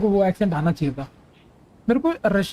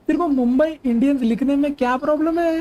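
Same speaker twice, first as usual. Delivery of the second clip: exasperated scolding; 6 semitones higher than usual. Real, not acted. Scolding a chatter over unclear abbreviation.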